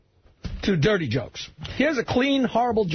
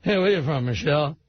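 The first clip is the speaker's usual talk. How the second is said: [name] impression; 6 semitones lower than usual. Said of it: "[name] is on stage".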